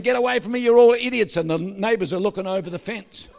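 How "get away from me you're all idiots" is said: higher, louder frantic scream; retold woman screaming to be left alone. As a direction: shriek at the crowd to back off. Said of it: "she's screaming".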